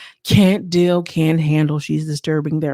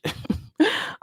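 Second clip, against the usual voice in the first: breathless laugh; 10 semitones higher, 5 dB quieter than usual. Real, not acted. Laughing at her own quote.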